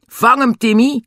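high, loud order to a dog; calling command. Acted. Dick sends Timmy after it, calling out. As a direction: egg the dog on.

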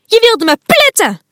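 high, loud accusing yell; angry shout. Acted. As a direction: hurl the accusation. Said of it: "roept ze tegen Fuchsia".

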